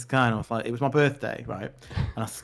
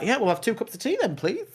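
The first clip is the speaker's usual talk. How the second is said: higher, overly polite request for tea; high, prim little order; mock posh order; 8 semitones higher than usual. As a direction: order tea like it's perfectly normal. Ordering tea in a club, acted.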